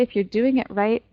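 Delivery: ordinary talk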